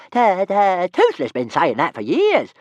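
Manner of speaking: very loud, high dragon squeal; excited. A narrator's reading. Toothless cheering for a fight, excited. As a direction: burst in eagerly. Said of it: "broke in Toothless excitedly".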